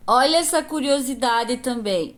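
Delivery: normal talking voice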